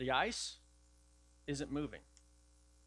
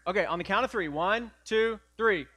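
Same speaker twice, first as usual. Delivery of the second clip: loud count-in; 5 semitones higher, 11 dB louder than usual. Shown as a demonstration. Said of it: "counting in the congregation".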